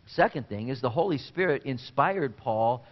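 usual speaking voice

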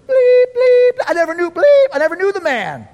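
high, loud frantic outburst with shouted bleeps; losing his mind. Acted. Peter cursing as he denies Jesus, frantic rage. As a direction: explode into censored-cursing denial. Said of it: "And then he loses his mind".

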